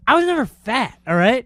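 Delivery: high-pitched, defensive retold comeback; indignant protest. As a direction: act out an offended denial. Retold defence against insult.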